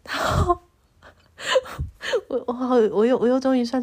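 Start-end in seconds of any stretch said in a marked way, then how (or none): 1.4-2.2 squeaky embarrassed laugh; a sudden high giggle; high breathy laugh. Real, not acted. Laughing at herself.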